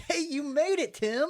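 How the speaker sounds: high, cheery hail to a buddy; hammed-up happy welcome; excited greeting. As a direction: greet a pal mid-celebration. Acting out Jason greeting his friend.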